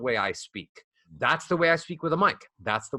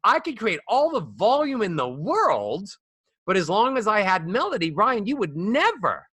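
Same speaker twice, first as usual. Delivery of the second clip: "all the volume in the world" in big high swoops; volume with lots of melody; 5 semitones higher than usual. Shown as a demonstration. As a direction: swing "you would never" up high, melodically. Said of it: "when I get louder that I have a lot of melody".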